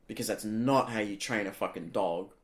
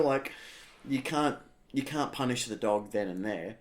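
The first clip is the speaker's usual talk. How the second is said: ordinary talk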